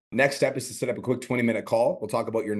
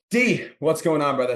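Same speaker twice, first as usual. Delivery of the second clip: higher, louder, drawn-out hello; upbeat video greeting; 6 semitones higher, 5 dB louder than usual. Shown as a demonstration. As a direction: greet a client with big energy. Demonstrates opening of video message.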